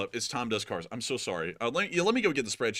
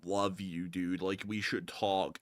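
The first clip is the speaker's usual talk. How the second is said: soft, lower, slow fake-friendly plea; a light sugary imitation; mock sweet YouTuber; 4 semitones lower, 5 dB quieter, slower than usual. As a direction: coo it like a needy friend. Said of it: "he'll be like".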